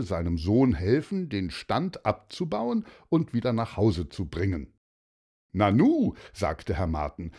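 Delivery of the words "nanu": raised, louder exclamation of surprise; surprised father. Acted. Herr Marten finding nearly everything sold, loudly surprised. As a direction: register surprise, up and loud.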